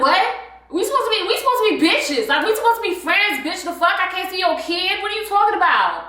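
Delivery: high-pitched offended outburst; mad, indignant. Retold or imitated. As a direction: act out affronted disbelief at a friend. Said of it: "No, I was low-key mad".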